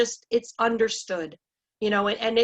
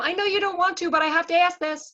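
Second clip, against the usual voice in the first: much higher, louder mock apology; unconfident, apologetic ask; 9 semitones higher, 4 dB louder than usual. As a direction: imitate a reluctant asker, voice pushed way up. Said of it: "'cause your voice went up".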